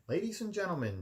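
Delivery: a high, loud dramatic announcement; grand, high announcing voice. A narrator's reading. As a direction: hush the room like a butler with news. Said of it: "she said dramatically".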